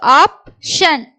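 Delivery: slow, split in two; syllable by syllable. Shown as a demonstration. Teacher sounds out the word for learners, syllable by syllable. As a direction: pause between the syllables of "option".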